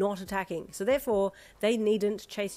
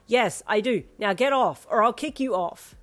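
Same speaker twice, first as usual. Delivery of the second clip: threatening; 3 semitones higher, 8 dB louder than usual. A narrator's reading. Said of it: "you threatened".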